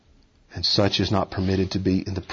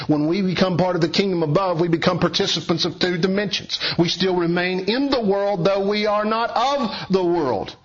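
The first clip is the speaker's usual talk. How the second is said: a higher, urgent pulpit delivery; raised preaching voice; 8 semitones higher than usual. Acted. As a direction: preach it out to the congregation.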